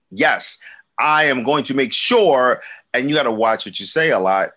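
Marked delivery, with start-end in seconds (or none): 0.1-2.6 loud, deliberately crisp and fully pronounced; over-enunciated. Shown as a demonstration. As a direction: enunciate every word emphatically, like a careful podcaster. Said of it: "has to be enunciated and pronounced".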